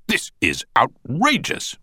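slow, high-pitched outrage; slowly emphatic courtroom indignation; indignant objection. A narrator's reading. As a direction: draw out each word in protest. Counsel repeats his outraged objection.

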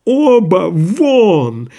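high, long-drawn shout; continued shouting. A narrator's reading. Doctor still orders both men out, shouting. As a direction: throw them out with a drawn-out cry. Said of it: "продолжал кричать Андрей Ефимович".

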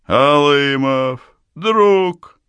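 put-on loud, high, slurring drunken shout; drunken yell. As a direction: bellow it like a happy drunk. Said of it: "пьяно заорал Бережной".

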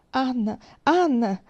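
loud, high call for help; desperate shout. A narrator's reading. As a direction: cry out the name in distress. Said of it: "gridò Charles".

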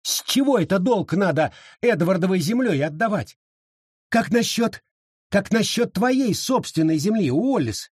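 high-pitched, indignant woman; indignant challenge. A narrator's reading. Abita challenges Wallace over his own land, indignant.

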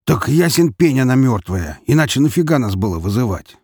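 loud, high-pitched puzzled protest; astonished, emphatic retort; surprised. A narrator's reading. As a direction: protest that it's obvious. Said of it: "удивился Валера".

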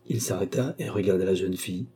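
ordinary delivery

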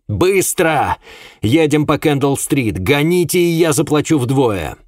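high, frantic command to a driver; urgent shouted order. A narrator's reading. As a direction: urge the cabman on at full voice. Frantically hailing a cabman, urging speed.

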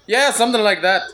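put-on Irish leprechaun lilt; leprechaun accent. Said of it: "that was my leprechaun accent".

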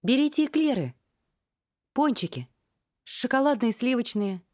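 high squeaky hesitant call; squeaky unsure cry. Acted. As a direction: squeak it out shyly like a nervous seller. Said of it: "неуверенно выкрикнула она внезапно писклявым голоском".

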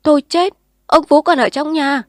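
loud, high shriek of alarm; panicked scream that someone is trapped; terrified cry. A narrator's reading. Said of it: "kêu thất thanh".

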